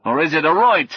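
high, loud questioning call; loud, uncertain challenge; shouted query. A narrator's reading. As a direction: call it out, then question the word. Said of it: "he shouted".

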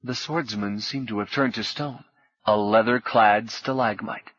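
ordinary delivery

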